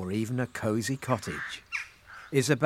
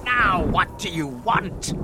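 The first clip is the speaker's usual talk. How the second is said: slow, exasperated demand, pitch raised; angry; 5 semitones higher, slower than usual. Acted. Magic fish confronts the returning wisher, angry. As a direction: demand it crossly, drawing out the words. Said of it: "shouted the magic fish angrily".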